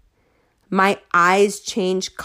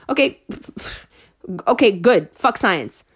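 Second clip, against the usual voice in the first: usual speaking voice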